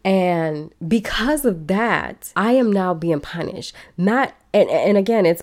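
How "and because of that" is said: ordinary talk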